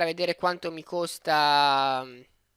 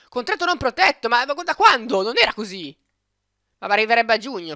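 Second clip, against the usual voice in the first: excited surprise; 5 semitones higher, 5 dB louder than usual. Real, not acted. Excited about unprotected contract.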